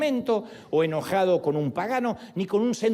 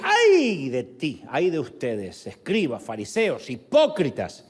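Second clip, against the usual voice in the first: Jesus rebuking; slower than usual. Retold or imitated. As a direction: thunder the first ay then drive on. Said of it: "Y Jesús decía".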